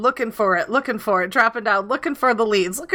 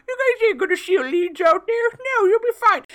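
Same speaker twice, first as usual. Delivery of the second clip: high, sing-song parody of a carefree promise; ribbing the friend who promised safety, high and mocking; mocking reassurance; 9 semitones higher than usual. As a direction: throw his words back at him, sarcastically.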